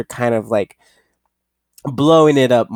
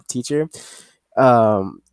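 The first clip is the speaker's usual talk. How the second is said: ordinary delivery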